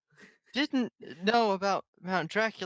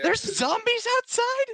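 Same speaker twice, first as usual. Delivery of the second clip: high, drawn-out startled question; alarmed yell; 11 semitones higher, slower than usual. Acted. Player's character hears of zombies, yelled question. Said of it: "Cora fucking yelled that".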